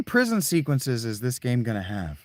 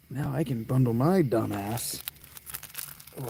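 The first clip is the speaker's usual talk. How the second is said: ordinary talk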